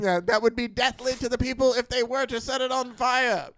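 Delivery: 1940s announcer voice. Pretend. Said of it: "nineteen forties voice you're using".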